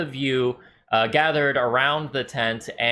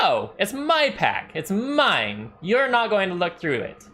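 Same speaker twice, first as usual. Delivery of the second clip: a touch of defiance; 8 semitones higher than usual. Acted. Halfling refusing, indignant.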